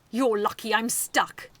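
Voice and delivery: loud, slow furious threat; enraged. A narrator's reading. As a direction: threaten through rage.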